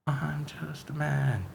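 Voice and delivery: soft slow put-on accent; Spider-Man accent. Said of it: "accent shifts".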